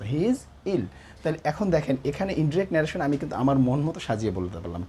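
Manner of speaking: normal talking voice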